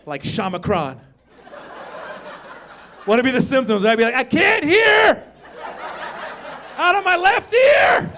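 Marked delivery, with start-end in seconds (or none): 4.1-8.1 mock alarm. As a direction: shout as if half deaf. Acts out imagined symptoms in alarm.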